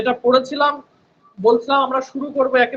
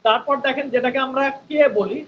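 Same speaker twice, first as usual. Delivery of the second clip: ordinary talk, nothing marked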